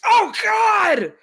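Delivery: shouted, high-pitched cry of pain; screaming in pain. Acted. Bleeding boss screams in pain at his desk, high shout. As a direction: yell it like a stapler just hit your eye.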